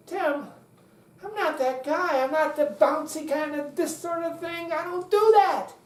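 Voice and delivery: high-pitched, whiny objection rising at the end; protesting student. Acted. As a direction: object as a reluctant pupil. Voicing a reluctant listener's objection.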